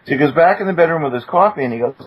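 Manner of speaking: ordinary talk, nothing marked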